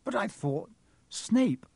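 high, confused protest; bewildered. A narrator's reading. Harry shocked to find Quirrell.

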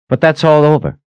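urgent high protest. A narrator's reading. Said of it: "Caleb protested".

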